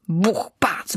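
breathy whispered sound effect; hushed onomatopoeia for knocking; whispered bang. A narrator's reading. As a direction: whisper the knocks like distant thuds. Noises inside the oak.

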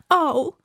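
sudden high yelp; ow cry. Acted. Little bauble yelping when struck. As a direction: cry out at the bump. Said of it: "Vykřikla najednou malá".

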